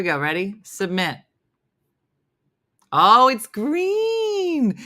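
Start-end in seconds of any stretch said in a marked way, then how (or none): 2.9-4.8 high, loud, drawn-out exclamation; drawn-out reaction to a reveal; exclaiming. Real, not acted. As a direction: stretch "green" out long and high. Reacts to the game result.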